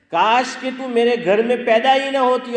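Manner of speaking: loud, high-pitched outburst of regret; exasperated father. Acted. Father to the child who torments him, exasperated outburst. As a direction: cry out like a father at the end of his patience. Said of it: "باپ بولتا ہے".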